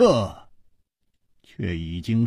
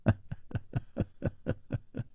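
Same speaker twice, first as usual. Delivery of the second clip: long breathy drawn-out laugh; 13 dB quieter than usual. Acted. Li Yuhan laughs at the two loyal friends, long laugh. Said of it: "李玉涵长笑道".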